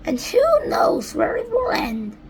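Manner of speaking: high, gloomy shout; earthworm voice. Acted. Earthworm fretting about the journey, high gloomy voice. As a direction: fret it aloud.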